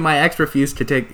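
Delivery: ordinary talk, nothing marked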